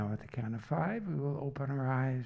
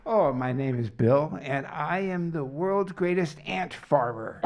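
loud, higher, gleeful bragging; louder, playful greatest-of-all-time claim; silly boast; 4 semitones higher, 10 dB louder than usual. Pretend. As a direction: ham up the silly brag. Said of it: "be as silly as you can".

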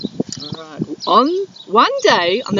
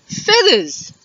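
surprised dingo; 8 semitones higher than usual. Acted. Dingo perks up at feathers.

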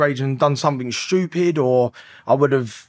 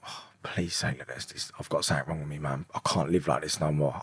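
very low, hushed pleading; desperate inward plea; 9 semitones lower, 11 dB quieter than usual. Retold or imitated. Man pleading silently in the diagnosis meeting, hushed and desperate. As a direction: retell a despairing thought under your breath.

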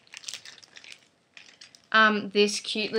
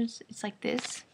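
soft breathy whisper; 11 dB quieter than usual. Real, not acted. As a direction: hush as if showing a secret. Showing the opened pad.

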